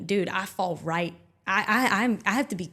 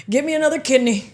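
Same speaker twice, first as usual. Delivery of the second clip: raised, higher mock-surgeon call; mock surgeon demand; 5 semitones higher, 8 dB louder than usual. Joking about a yelling surgeon, mock demand. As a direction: call out like a surgeon mid-operation.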